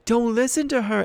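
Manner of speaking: loud, high urgent shout. A narrator's reading. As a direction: call out through the statues. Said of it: "voice shouted".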